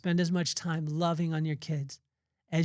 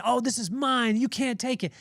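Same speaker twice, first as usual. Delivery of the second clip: put-on higher, childish mine-mine tone; mock possessive whine; 7 semitones higher than usual. Preacher mocking a grabby attitude, higher and childish. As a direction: mimic a grabby child guarding his toy.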